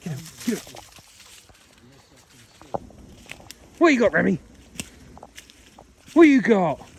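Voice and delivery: high praise call to a dog. Real, not acted. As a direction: call out to the dog, bright and loud. Calling the dog.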